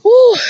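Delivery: loud high exhale of feeling; emotional whew. Real, not acted. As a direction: let out a big overwhelmed whew. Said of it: "I get emotional".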